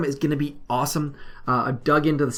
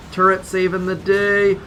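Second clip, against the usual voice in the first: louder, higher, drawn-out cheer; excited cheer; 6 semitones higher, 7 dB louder, slower than usual. Real, not acted. Player cheering his turret on, excited cheer. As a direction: draw it out like a triumphant cheer.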